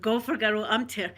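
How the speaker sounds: ordinary talk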